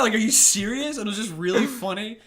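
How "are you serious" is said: raised mock disbelief; retold incredulity; freaking out. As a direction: ask it loud and high, imitating an incredulous cop. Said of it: "Like, freaking out".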